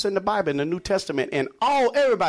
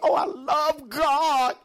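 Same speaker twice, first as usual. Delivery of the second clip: mock pious; 7 semitones higher, much slower than usual.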